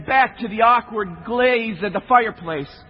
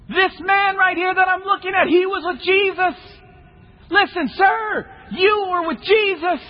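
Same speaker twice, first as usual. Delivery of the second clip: accusing shout; 9 semitones higher than usual. Acted. Servant girl accuses Peter loudly.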